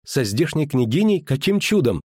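put-on high, incredulous girlish questions; astonished disbelief. A narrator's reading. Girl learning of her friend with the princess, astonished. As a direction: pitch it up in amazement.

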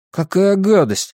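high-pitched recoil in disgust. A narrator's reading. Boy eyes the sewage pipe, disgusted. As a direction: react as if it turns your stomach. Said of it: "поморщился Стэн".

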